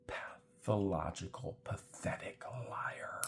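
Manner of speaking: slow, soft, contemptuous whisper. Real, not acted. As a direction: hiss the insult quietly. Contemptuous aside.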